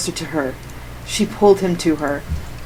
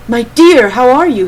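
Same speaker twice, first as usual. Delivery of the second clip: loud, over-hearty greeting; much too loud; 5 semitones higher, 8 dB louder than usual. Acted. Damon greeting Heaven in front of everyone, far too loud. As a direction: boom the greeting across the room. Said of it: "much too loud".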